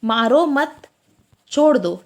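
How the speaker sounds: मारो मत together, clear gap, then छोड़ दो; pause after मत. Shown as a demonstration. Teacher explains the first meaning, pause after मत. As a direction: stop after मत before releasing छोड़ दो. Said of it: "पहले का मतलब अलग है".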